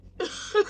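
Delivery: quick airless laugh under the breath; a light laugh to herself; breathy laugh. Real, not acted. Laughs after I'm not about it.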